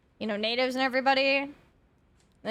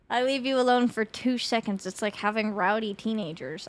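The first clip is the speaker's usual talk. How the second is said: ordinary talk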